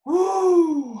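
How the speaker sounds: a high, loud startled woo; sudden woo. Real, not acted. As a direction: blurt out a startled whoop. Sudden outburst mid-sentence, then excuse me.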